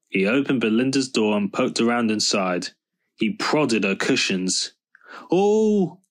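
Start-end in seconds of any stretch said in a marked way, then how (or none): 5.3-6.0 high, drawn-out cry of dismay; shuddering. A narrator's reading. Belinda as Snoop prods her cushions, shuddering high. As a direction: let out a high "oh" of revulsion. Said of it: "shuddered Belinda".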